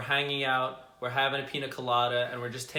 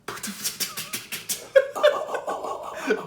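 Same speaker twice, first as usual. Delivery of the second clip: imitated helicopter chopping; 6 dB louder than usual. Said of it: "it kinda sounds like".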